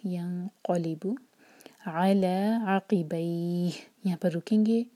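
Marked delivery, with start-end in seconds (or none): 0.1-3.7 slow chant, heavy क़ाफ; Quran recitation. Shown as a demonstration. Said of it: "क़ाफ heavy".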